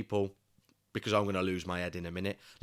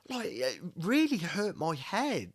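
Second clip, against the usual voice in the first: exasperated; 7 semitones higher than usual. Real, not acted. Repeats complaint twice.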